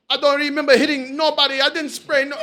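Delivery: loud, high Jamaican accent impression. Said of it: "My Jamaican accent is crazy".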